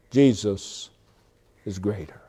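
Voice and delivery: low, soft and falling away; hushed first take. Shown as a demonstration. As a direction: let the words sink quietly, dropping off at the end. First take before emphatic repeat.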